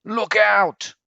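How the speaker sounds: high loud cry of warning; alarm call. Acted. Jagged-fin fish sounding the alarm, high loud shout. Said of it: "sounded the alarm".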